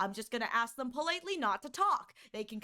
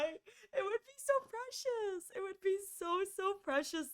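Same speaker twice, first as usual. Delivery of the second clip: cooing fondly at the thought of Granny Firefly; 7 semitones higher than usual. Real, not acted. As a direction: melt over the idea.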